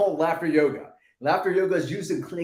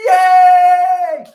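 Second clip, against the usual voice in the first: a big, high, much louder shout of joy; childlike yay shout; 11 semitones higher, 15 dB louder than usual. Shown as a demonstration. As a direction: cheer like a kid who just won. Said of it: "Say it with me".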